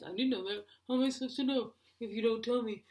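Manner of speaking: defensive husband impression; mock husband voice. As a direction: plead ignorance like a dopey husband. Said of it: "That's my husband".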